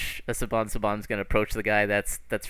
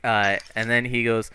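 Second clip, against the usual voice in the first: ordinary delivery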